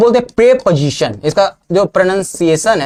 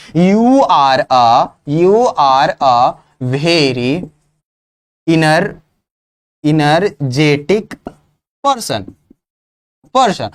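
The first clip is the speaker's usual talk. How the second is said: very slow, word by word, lower; slow drawn-out sentence; 6 semitones lower, much slower than usual. Shown as a demonstration. Teacher spelling out a sentence slowly for the class. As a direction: stretch each word out as a model sentence. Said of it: "हम ऐसे नहीं बोलते हैं".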